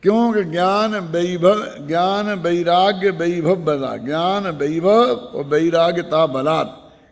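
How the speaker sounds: slow, measured verse recitation; scripture recitation. Acted. Preacher reciting and glossing a verse for listeners, slow and measured. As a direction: draw out each phrase of the verse deliberately.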